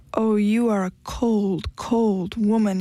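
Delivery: slow, pained lament; crying out in hurt. A narrator's reading. Husband wailing at his wife for her coldness, slow and pained. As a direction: let the words drag out of a wounded man. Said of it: "he cried".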